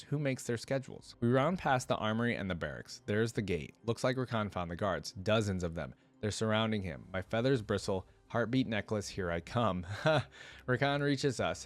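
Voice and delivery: ordinary delivery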